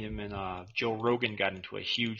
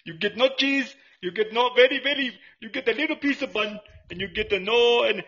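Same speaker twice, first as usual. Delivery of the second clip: higher, louder broken-English shopkeeper voice; hot dog vendor character; 10 semitones higher, 6 dB louder than usual. Acted. Vendor taunting the customer, higher and louder in broken English. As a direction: haggle like a cheeky street vendor.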